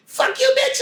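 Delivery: high shouted insult; yelled retort. Retold or imitated. Actor yells back at the teacher while running out. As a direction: throw it back over your shoulder.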